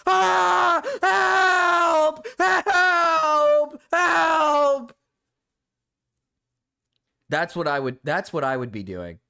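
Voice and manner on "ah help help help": high, loud screaming "Help!"; hammed-up panicked cries from atop a firework; mock screams for help. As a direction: yell for help like you're stuck on a rocket. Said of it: "I would be like".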